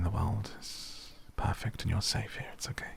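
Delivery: soft hushed whisper; whispering over a crib. Pretend. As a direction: whisper as if lulling a baby. Hushing villager back to sleep.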